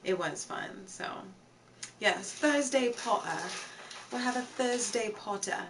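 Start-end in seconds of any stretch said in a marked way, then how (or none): 2.0-5.6 put-on British accent. Streamer promising viewers a Harry Potter day, mock British accent. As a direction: have a go at an amateurish British accent. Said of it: "a British accent".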